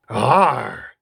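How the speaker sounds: loud creature grunt of Arr. Acted. Agnew the creature replies to host with loud Arr. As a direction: Agnew grunts his point. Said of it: "You're right, Agnew".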